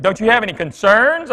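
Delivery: a teacher who can't believe the dad; incredulous teacher. Retold or imitated. Teacher pressing the dad at the conference, incredulous.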